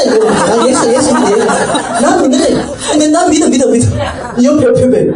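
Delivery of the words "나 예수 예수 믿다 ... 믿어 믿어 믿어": eager insistence. Retold or imitated. Roommate insisting she believes.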